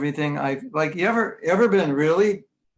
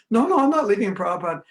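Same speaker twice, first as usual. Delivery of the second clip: quick, higher, louder protest; indignant mock objection, rushed and raised; 6 semitones higher, 5 dB louder, faster than usual. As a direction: blurt out a hasty denial. Acted objection in imagined exchange.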